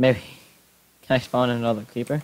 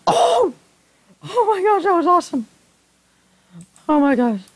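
very high, loud, amazed shriek; thrilled squeal; 5 dB louder than usual. Real, not acted. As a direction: squeal in sheer delight at the spectacle. Lightning hits target, player thrilled.

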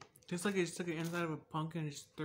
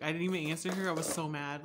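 ordinary talk, nothing marked

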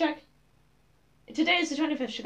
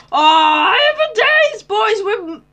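high, loud and slow joyful wail; delighted cry; 8 semitones higher, 7 dB louder, much slower than usual. Real, not acted. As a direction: savor the jackpot out loud. Finding more iron, delighted cry.

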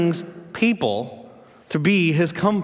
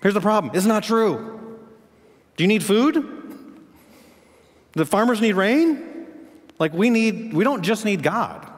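full-force preaching; 8 semitones higher, slower than usual. Acted. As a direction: preach it hard to the congregation.

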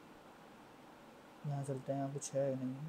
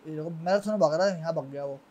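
usual speaking voice

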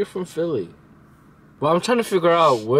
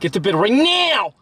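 higher mock yell, punching NOW; imitated scolding parent; 7 semitones higher than usual. Imitating partner sending kids to bed, mock angry. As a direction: mimic a cross bedtime order. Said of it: "when kids don't go to bed, you're like".

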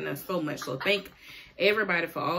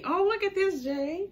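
excited; 12 semitones higher than usual. Real, not acted. Mom excited over shirt.

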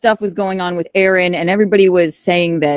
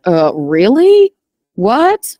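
mock disbelief; 2 semitones higher, much slower than usual. Guest retells hearing of the divorce, incredulous. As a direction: stretch it upward in retold bewilderment. Acting out their startled reaction.

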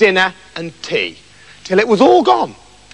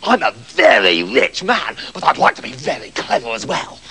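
greedy Septimus; 7 semitones lower than usual. Acted. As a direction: savour it like a greedy rich man.

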